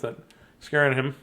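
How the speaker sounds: usual speaking voice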